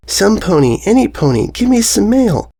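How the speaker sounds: high, sing-song plea for mail; Derpy calling. Acted. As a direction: call out into the night. Derpy's ghostly call.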